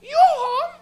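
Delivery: put-on high, slow Portuguese accent, revelation; Portuguese mother with a heavy accent, realising her son smokes weed; Portuguese mom. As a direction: piece it together like a revelation. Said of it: "she goes".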